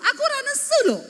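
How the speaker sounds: loud, very high, fast protest, acted; nesu tenanan. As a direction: deny being angry while sounding angry. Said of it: "malah jadi nesu tenanan". (pura-pura marah, cepat dan melengking)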